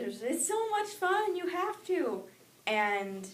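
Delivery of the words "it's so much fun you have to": put-on high-pitched pleading kids; excited begging. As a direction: beg like an eager child, voice way up. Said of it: "they were all begging me".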